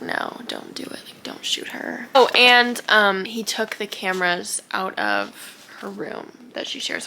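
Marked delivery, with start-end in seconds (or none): 0.0-2.1 soft, voiceless quote; hushed retold plea. Retelling Warner stopping the guards, hushed. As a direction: drop to a hush, imitating him. Retold order to hold fire.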